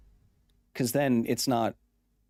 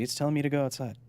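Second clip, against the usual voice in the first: ordinary delivery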